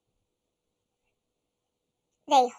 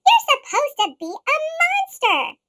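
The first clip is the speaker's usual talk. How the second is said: higher, louder scold; 7 semitones higher, 6 dB louder than usual. Acted. Monsters scolding Monster, loud and indignant. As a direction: tell him off.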